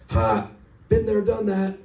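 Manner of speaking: high, loud teasing ha; mocking jeer. Second cop pointing at the driver, loud mocking jeer. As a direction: rib him, amused.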